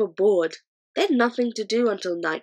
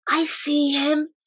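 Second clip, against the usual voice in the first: quivering whisper; 8 semitones higher, slower than usual. A narrator's reading. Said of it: "Sophie whispered back, quivering".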